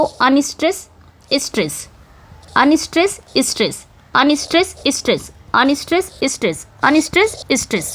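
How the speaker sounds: Unstress rising, stress falling, with short gaps; alternating metrical beats. Shown as a demonstration. Teacher marks a poem's meter pair by pair, in alternating tune. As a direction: count off unstress, stress in an up-down sing-song.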